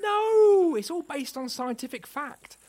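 very high squeaky protest; indignant outburst. Acted.

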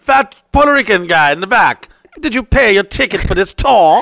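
loud, high, hammed-up authority; mock guard's challenge. Guard calling out a freeloader at the back, mock-commanding shout. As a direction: challenge him across the crowd like an officious guard.

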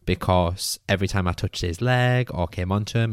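ordinary delivery

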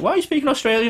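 high-pitched, incredulous retold question; mock disbelief, voice jumping high; baffled question. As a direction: ask it as a puzzled coworker, pitched right up.

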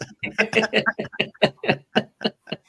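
long breathy laugh; helpless airy laughter. Real, not acted. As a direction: burst out in a long, voiceless laugh. Laughing at own remark.

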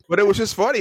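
ordinary talk, nothing marked

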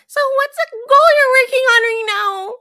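high, loud, put-on chirpy questioning; mock earnest question. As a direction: parody an overly eager interviewer friend. Mocking a stiff interview-like friend question.

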